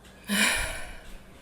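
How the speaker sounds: big open exhale; demonstrated sigh. Shown as a demonstration. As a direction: let a sigh drop out to find your voice. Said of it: "That's where your voice lies".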